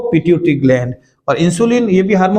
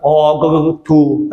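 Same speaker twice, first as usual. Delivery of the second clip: imitated gargling sounds ending in a spit; mock gargle and spit; much slower than usual. Teacher imitating students gargling salt water, mock gargle. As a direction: gurgle then spit like rinsing salt water. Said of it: "ऐसे करते हो ना".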